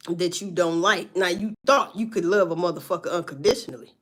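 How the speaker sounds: ordinary delivery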